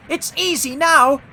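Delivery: high, loud elation; triumphant. A narrator's reading. James finding the load lighter, elated. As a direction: crow it happily, pitched high. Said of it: "he puffed triumphantly".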